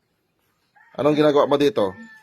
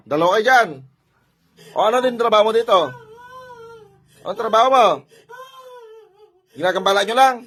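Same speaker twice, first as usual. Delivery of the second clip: raised, high-pitched confrontation; stern interrogation; 8 semitones higher, 5 dB louder than usual. Real, not acted. Healer asking two spirits what their job is, stern interrogation. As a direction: confront them with a high, forceful voice.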